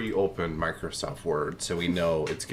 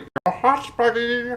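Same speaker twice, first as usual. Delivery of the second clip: loud, high cartoon-trailer imitation; shrieked movie quote ending in a loud cackle; kids quoting trailer; 9 dB louder than usual. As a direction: mimic excited schoolkids quoting a film, very high and loud. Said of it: "all the kids were like".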